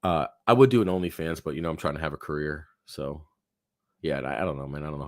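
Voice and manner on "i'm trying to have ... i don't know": usual speaking voice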